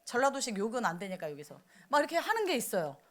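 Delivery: ordinary delivery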